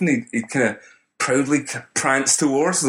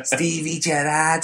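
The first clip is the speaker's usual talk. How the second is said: slow, higher Scouse Stevie Gerrard; Liverpool accent; 5 semitones higher, slower than usual. Retold or imitated. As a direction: say it like a Scouser, drawn out. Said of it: "Is that your Liverpool accent?".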